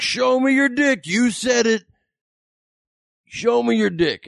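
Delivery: high-pitched, drawn-out mock yelling; worked-up, strained shout, acted out; enraged heckler. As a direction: imitate a heckler demanding it insistently. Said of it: "this set him off".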